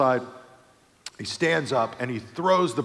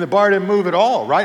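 ordinary talk

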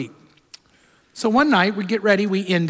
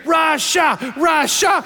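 put-on child cheering; 7 semitones higher, much slower than usual. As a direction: cheer the name like an excited kid. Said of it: "he starts cheering".

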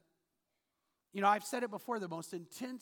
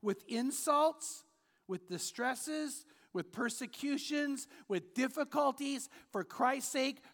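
each phrase drawn out slowly and higher; slow, emphatic scripture; 4 semitones higher, slower than usual. Acted. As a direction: lean hard on every item of the list. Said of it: "Now catch this next line".

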